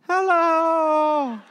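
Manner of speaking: high, loud call back; calling hello. Acted. Survivor calling up to rescuers, high loud hello. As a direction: answer the rescuers from a hole in the snow.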